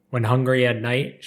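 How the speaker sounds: ordinary delivery, nothing marked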